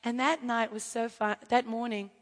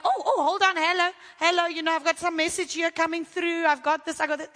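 flustered phone answering; 5 semitones higher, 4 dB louder than usual. Retold or imitated. Acted phone call interrupting intimacy.

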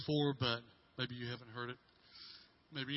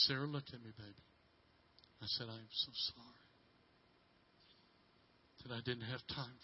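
softer, gentle and sincere; tender apology; 3 semitones lower, 6 dB quieter than usual. Retold or imitated. Father on his knees softly apologizing to his crying daughter. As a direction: kneel down and say sorry softly.

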